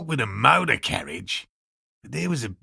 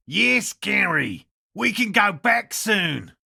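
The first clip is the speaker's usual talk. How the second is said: slow, high shouted reply; drawn-out high call to a companion; 9 semitones higher, slower than usual. Acted. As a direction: call over to Gary, stretched out. Calling out to Gary.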